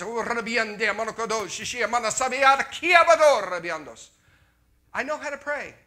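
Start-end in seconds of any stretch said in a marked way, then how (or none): 0.0-4.1 high, loud praying in tongues, building up; frantic tongues prayer. Retold or imitated. Preacher mocking his own frantic praying, loud tongues. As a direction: pray in tongues louder and higher. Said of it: "And I was".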